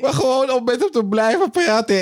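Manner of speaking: real laughter while telling the story; laughing. Real, not acted. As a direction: giggle your way through the memory. Laughing retelling of the stuttering joke.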